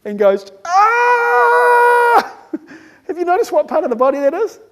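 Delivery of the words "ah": long, high mock scream; freaked-out scream. Brain freaking out at fasting, long loud mock scream. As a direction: scream on one held note. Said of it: "freaks out the thought of fasting".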